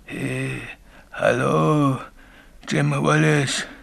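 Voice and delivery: calling ghost voice. Acted. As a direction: imitate a phantom calling someone's name. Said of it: "hörte ich auch die rufende Stimme". (langgezogene, rufende Geisterstimme)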